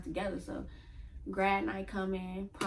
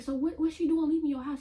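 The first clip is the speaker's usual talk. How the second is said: incredulous question; 9 semitones higher, 6 dB louder than usual. Retold or imitated. Retold confrontation of boyfriend.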